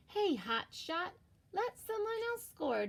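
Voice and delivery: high, teasing friend's jab; Joey's voice. Acted. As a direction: needle a teammate who hogs the puck. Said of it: "said his best friend Joey Mackerel".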